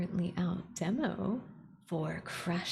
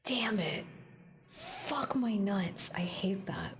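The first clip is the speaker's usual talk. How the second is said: muttered frustration; 2 semitones higher, slower than usual. Real, not acted. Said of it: "viscerally angry".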